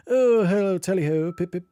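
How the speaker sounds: hammed-up upper-class British accent; posh British accent. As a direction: send up an old-fashioned posh British gent. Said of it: "this posh British accents".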